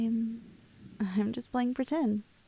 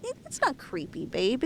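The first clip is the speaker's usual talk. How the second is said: ordinary talk, nothing marked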